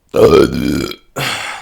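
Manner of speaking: heavy exaggerated sigh; big loud sigh. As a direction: exhale loudly, fed up. Dramatic sigh.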